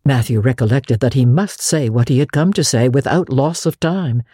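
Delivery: ordinary delivery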